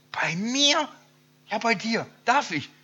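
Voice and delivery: high, loud, astonished little man; can't believe he's the one chosen; amazed disbelief. Acted. Zachäus stunned Jesus comes. (ungläubig staunend, hoch und laut)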